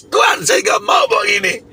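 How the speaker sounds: high, pleading shout, as if back in that night; retold cry to God. Preacher reliving his refusal to God, a high pleading shout. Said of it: "saya berteriak kepada Tuhan".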